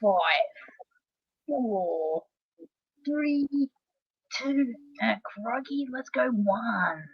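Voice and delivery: put-on Australian accent countdown, slowing and drawn out near the end; Aussie countdown. Cohost doing an Australian accent countdown for the streamer, hammed-up Aussie. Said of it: "give me an Aussie countdown".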